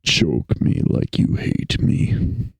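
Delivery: very low, slow, husky murmur. Pretend. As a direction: drop to a deep, drawn-out whispery rumble. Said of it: "do the corpse husband voice".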